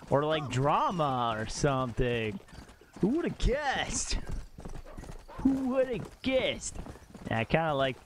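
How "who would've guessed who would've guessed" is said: slow, high theatrical swoop; mock astonishment. Streamer hamming up a saying for chat, high mock astonishment. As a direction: draw it out in high mock wonder. Repeating saying like drama class.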